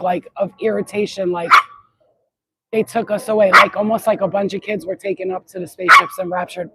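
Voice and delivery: ordinary talk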